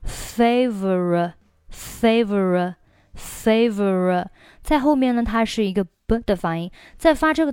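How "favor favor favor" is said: slow "favor" as fay-vuh-ruh; drawn-out "favor", each syllable clear; syllable building. Shown as a demonstration. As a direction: blend the sounds of "favor" slowly. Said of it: "所以是".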